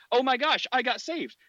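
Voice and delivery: hammed-up excitement at being saved; mock amazed convert. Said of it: "they're like".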